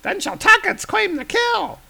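very high, squeaky creature voice; squeaky goblin. Acted. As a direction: shriek with eager bloodlust. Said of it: "find him and says".

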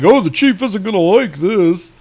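hammed-up cartoon sidekick voices; worried henchmen. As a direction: fret like a henchman dreading the boss. Dim henchmen dreading the chief, cartoon parody.

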